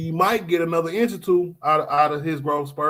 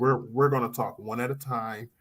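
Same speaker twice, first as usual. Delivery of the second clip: ordinary talk, nothing marked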